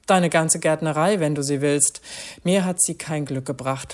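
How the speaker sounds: usual speaking voice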